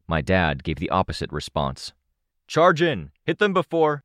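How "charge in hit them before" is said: high, urgent battle command; shouted order. A narrator's reading. Dad urging an attack amid the jumble, shouting. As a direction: shout the order until cut off.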